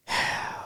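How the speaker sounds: long, soft exhale of disbelief; exasperated sigh. Real, not acted. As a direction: let out a weary, exasperated sigh. Disbelief at the presentation.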